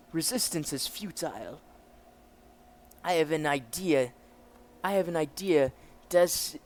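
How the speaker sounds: commanding officer with a put-on German accent; German officer character. German officer explains the prisoner's state, acted officer voice.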